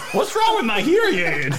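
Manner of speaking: put-on high, confused old-timer voice; old person voice. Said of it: "all the old people be like".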